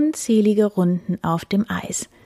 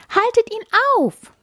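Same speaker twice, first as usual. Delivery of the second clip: very high urgent cry; alarmed call; 4 dB louder than usual. A narrator's reading. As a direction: call out in alarm as the little deer. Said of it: "rief das kleine Reh". (aufgeregt hoch gerufen)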